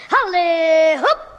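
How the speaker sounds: slow drawn-out cowboy yell; stretched, loud giddy-up call; cowboy cry. Acted. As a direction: whoop it like a cowboy mounting his horse. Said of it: "watch how I jump on my bike, just like a cowboy".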